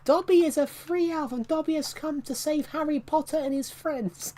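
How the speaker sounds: high, squeaky house-elf voice; Dobby voice. Pretend. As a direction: squeak it like a proud little elf. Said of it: "I can't do Dobby".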